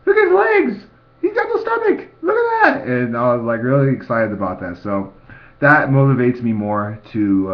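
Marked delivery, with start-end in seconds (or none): 0.0-2.9 high, loud mock-mom squeal; mom amazed. Son imitating his mom circling him, high excited squeal. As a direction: gush like a mother circling her son. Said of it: "She's like".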